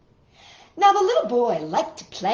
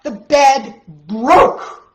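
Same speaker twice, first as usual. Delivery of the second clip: slow, loud and higher; dramatic climax; 5 semitones higher, 11 dB louder, much slower than usual. Acted. Reading to children, the big moment the bed breaks. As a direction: build the suspense then land it.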